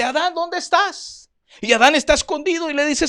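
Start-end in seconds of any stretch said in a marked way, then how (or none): 0.0-1.3 high, slow calling voice of God; God calling Adam. Acted. God calling out to Adam hiding in the garden, high and slow. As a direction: call out searchingly, drawn out.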